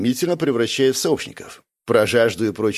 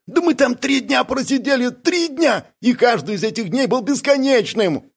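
very high, aggrieved whine; indignant outburst; 12 semitones higher than usual. A narrator's reading. Бяка protesting three endless days hidden, indignant. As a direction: protest with the voice pitched way up. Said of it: "вскинулся Бяка".

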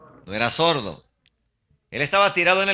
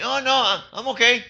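high-pitched acted brush-off; polite refusal; 8 semitones higher than usual. Hungry youth declining a burger, acted polite refusal. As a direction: lightly turn the offer down in a higher voice. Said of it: "Él no va a decir".

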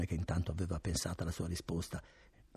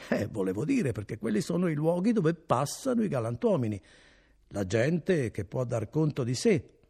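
higher, louder innkeeper explaining; lightly voiced innkeeper; 8 semitones higher, 6 dB louder than usual. A narrator's reading. Innkeeper smoothing over his question to Renzo, lightly voiced. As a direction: cover the nosy question with bluster.

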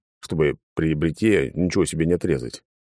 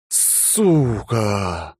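exhausted breathy swear; exhaled curse; 3 semitones higher than usual. A narrator's reading. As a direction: sigh the curse out while slumping on the wall. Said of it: "выдохнул я".

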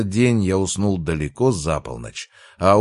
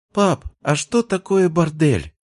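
put-on high, slow little-girl question; curious child; 12 semitones higher, 4 dB louder than usual. A narrator's reading. Little sister asks father about a word, curious child. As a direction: pipe up with innocent curiosity. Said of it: "немедленно заинтересовалась Вика".

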